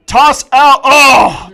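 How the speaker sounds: loud, high sudden call; excited play call. Real, not acted. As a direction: blurt out the play.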